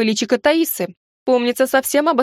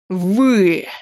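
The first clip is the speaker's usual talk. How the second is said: furious tsar; 3 semitones lower than usual. Acted. Enraged tsar outburst.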